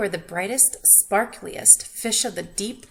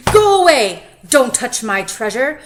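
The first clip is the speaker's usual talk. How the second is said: growling lobster; 2 semitones higher, 15 dB louder than usual. A narrator's reading. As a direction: shout like a furious lobster guarding gold. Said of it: "the lobster growled".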